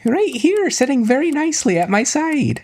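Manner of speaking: very high, sugary falsetto greeting; Rose's sweet welcome. Acted. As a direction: gush like a doting girlfriend. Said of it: "Rose opens it".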